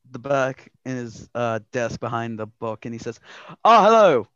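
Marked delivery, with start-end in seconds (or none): 3.6-4.3 higher, louder cheery greeting; the shopkeeper Leon greeting adventurers; Leon's voice. Acted. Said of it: "What is the voice I gave him?".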